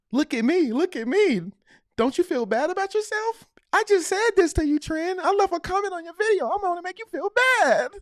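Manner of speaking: high, mocking devil voice whispering doubts; the devil taunting. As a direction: taunt him like a sneering little devil. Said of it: "that's like the devil speaking to you and saying".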